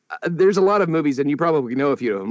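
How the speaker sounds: ordinary delivery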